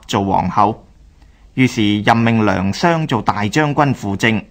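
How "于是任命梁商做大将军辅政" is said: ordinary delivery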